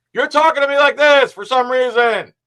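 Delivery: mock caps-lock yelling. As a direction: bellow it the way caps lock reads. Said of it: "that's how I read it".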